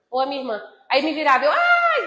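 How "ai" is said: sharp high cry of pain, suddenly loud. Retold or imitated. A preacher reliving her scream of pain when turned in bed. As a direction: yelp as the broken ankle is moved.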